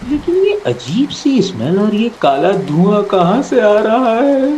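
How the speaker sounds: high, drawn-out worried question; mounting alarm. Acted. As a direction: stretch the question out as alarm grows. Notices smoke from burning breakfast.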